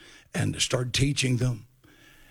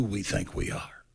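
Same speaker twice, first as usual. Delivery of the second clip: whisper; 5 semitones lower, 6 dB quieter than usual. Shown as a demonstration. Repeated line, dropped to whisper.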